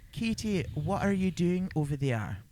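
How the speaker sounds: demonstrating a Scottish accent. Shown as a demonstration. Host models a line for co-host to copy, Scottish accent. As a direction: say it with a Scottish lilt. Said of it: "Can you do a Scottish accent?".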